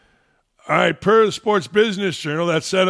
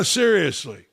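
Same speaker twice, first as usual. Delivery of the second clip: normal talking voice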